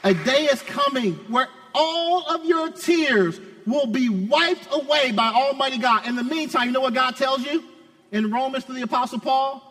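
high-pitched, driving pulpit delivery; impassioned preaching. Real, not acted. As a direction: proclaim the promise to the congregation. Preaches at full force.

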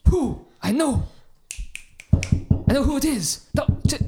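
high, loud burst of realization; excited revelation. Acted. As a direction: blurt it out as a comic breakthrough. Detective's sudden revelation.

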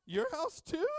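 high squeaky voice asking; mock surprised lady. Ladies in church asking about his house, high mock voice. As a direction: play a surprised woman, high.

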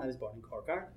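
low, soft, matter-of-fact reply; plain and simple answer. Shown as a demonstration. Panelist demos plainly answering where were you born, said softly. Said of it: "very plain and simple".

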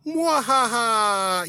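slow, high drawn-out villain cackle; hammed-up mad scientist laugh; evil laugh. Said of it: "evil laugh".

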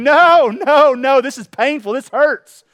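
loud, high-pitched cries of protest; acted-out outburst, high and loud; pained protest. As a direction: cry out against the pain as the clay. Clay protests while being pounded.

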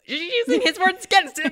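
high, loud, laughing through the words; laughing delight. Real, not acted. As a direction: laugh with glee at the payback. Excited laughing reaction.

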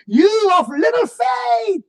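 rebuking shout. Retold or imitated. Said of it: "said to his disciples".

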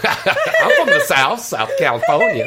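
high, drawn-out Southern drawl, laughing; teasing, exaggerated Southern belle drawl; mock Southern accent. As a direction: mimic her with a hammed-up Southern twang. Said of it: "that country accent coming out".

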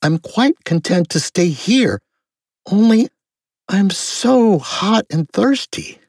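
high voice, slowing to a plea; weary girl Alice. A narrator's reading. Hot, tired Alice pleading.

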